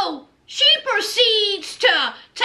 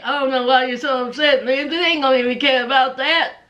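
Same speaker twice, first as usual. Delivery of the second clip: lower, fast offhand man; Blaine casual; 3 semitones lower, much faster than usual. Retold or imitated. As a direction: shrug it off, lower and quick. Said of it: "Blaine said".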